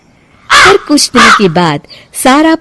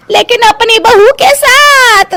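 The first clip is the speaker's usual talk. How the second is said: mock wail; 11 semitones higher than usual. Mamta fake wailing over bahu.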